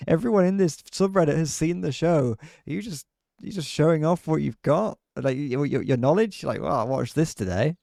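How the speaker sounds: high, loud mocking rant. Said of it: "And it's like".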